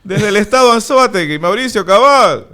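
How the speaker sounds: hammed-up news correspondent closing his report; Mauricio Cabal sign-off. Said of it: "Mauricio Cabal fue el que".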